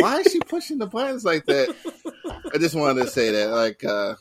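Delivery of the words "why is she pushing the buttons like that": high incredulous voice. Retold or imitated. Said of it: "I'm like".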